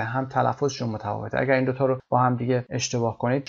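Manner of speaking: ordinary talk, nothing marked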